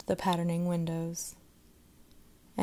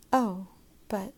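plain reading voice

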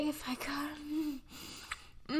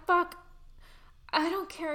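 usual speaking voice

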